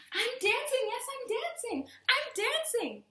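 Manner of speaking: high, loud delighted exclaiming; joyful cry. A narrator's reading. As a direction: burst out with happy disbelief. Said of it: "Gerald cried".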